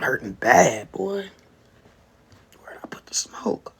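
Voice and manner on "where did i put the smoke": whispered searching question; absent-minded, voiceless self-talk; whispering to self. Real, not acted. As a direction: whisper it to yourself while hunting around. Still hunting for the smoke.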